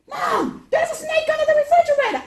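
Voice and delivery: high, loud, fast warning shout; alarmed yell. Retold or imitated. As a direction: yell it as if a snake is right there. Kids fake snake alarm.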